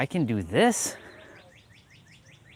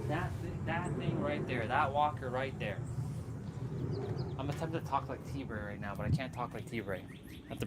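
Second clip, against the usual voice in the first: much softer, close to the mic; hushed; 11 dB quieter than usual. Real, not acted. Confiding to viewers about T-Bray, hushed softer voice. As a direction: drop the voice like a secret.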